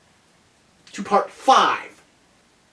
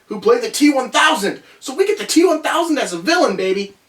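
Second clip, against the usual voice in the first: rising, louder excitement over the casting; excited; 8 semitones higher, 4 dB louder than usual. Real, not acted. Vlogger hyping the villain casting to viewers, excited. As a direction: hype it up, T one thousand as villain.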